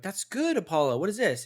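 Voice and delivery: praising owner. Retold or imitated. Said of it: "I'm like".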